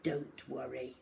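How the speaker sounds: put-on calm the child while threatening the rat; falsely soothing teacher. A narrator's reading. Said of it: "said Miss Midge".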